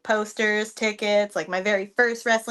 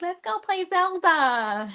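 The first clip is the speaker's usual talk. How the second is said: playful J P; 8 semitones higher, slower than usual. Retold or imitated. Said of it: "J P being like".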